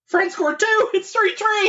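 thrilled high exclamations; blurting out big news; excited. Real, not acted. Live World Cup final goal news.

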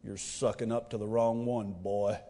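deep, quiet Scottish-tinged rumble; father-in-law impression. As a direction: growl it like Sean Connery, bald and unimpressed. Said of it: "I'm gonna do my father-in-law impression".

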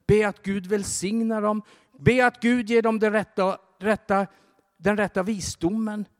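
high, loud, rising urgent exhortation to pray; fervent preaching. Acted. Preacher urging the congregation to pray, fervent preaching. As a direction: preach it harder with every line.

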